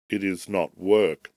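ordinary delivery

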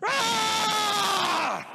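loud, high cry of joy bursting out; joyful shout. Retold or imitated. Pastor re-enacting his baptism for the congregation, joyful shout. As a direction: shout "Ah!" like coming up from the water. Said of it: "I come up with a shout".

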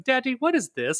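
put-on high, curious little-kid voice asking about the wrapped game; imitating his kid. Future son asks dad about the unopened game, high curious kid voice. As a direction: play the small son, lightly mocking, high and puzzled. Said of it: "one day he's gonna come up and go".

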